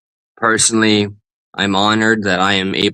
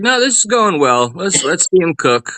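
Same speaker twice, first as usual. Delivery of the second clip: high-pitched imitation; 7 semitones higher than usual. Imitates co-host's supposed confident thought.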